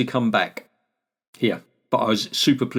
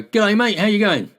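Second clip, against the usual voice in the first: hearty Kiwi greeting; 10 semitones higher than usual. Retold or imitated. Greeting passing Londoners the Kiwi way, high hearty hello. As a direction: greet a stranger like a friendly Kiwi, pitched up. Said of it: "And I go".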